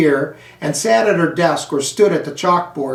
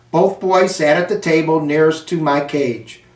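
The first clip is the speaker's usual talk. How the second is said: plain reading voice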